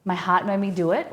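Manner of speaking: usual speaking voice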